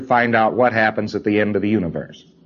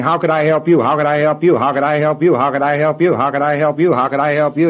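repeated quickly, higher and louder each time; rapid-fire commands; 5 semitones higher, 3 dB louder than usual. Shown as a demonstration. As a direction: hammer the question over and over without a pause. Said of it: "You're saying".

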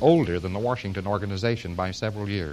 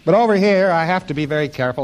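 normal talking voice

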